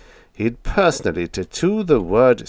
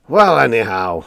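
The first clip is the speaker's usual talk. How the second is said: howled; 3 semitones higher, 7 dB louder than usual. A narrator's reading. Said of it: "howled the old man".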